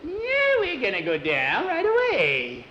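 high, swooping witch voice; gleefully menacing storybook witch. Acted. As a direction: start on a squeal, then swoop down and up. Witch answers before diving.

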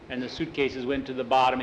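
plain reading voice

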